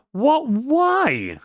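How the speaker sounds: high, whiny protest; exasperated disbelief. Real, not acted. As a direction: complain in high-pitched bafflement. Still protesting at the failing command.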